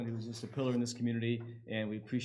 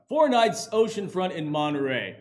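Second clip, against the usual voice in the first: big booming announcer read, a bit louder; 3 semitones higher, 8 dB louder than usual. Pretend. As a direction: present it like a fight-night announcer. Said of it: "in my best, uh, announcer voice".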